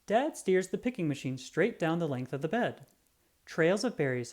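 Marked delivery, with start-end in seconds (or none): none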